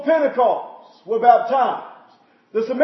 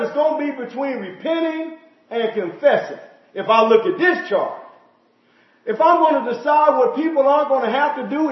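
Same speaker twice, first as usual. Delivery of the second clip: ordinary talk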